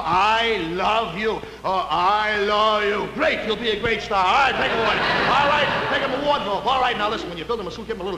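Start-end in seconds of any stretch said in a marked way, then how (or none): 0.0-3.1 very slow, swooning I love you; slow dramatic line. Shown as a demonstration. Diction coach correcting the line, slow and swooning. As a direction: melt into each word of I love you. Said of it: "No, no, no, no".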